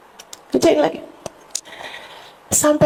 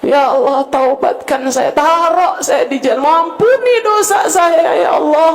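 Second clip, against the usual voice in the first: impassioned prayer; 7 semitones higher than usual. Acted. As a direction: cry out to God with full force.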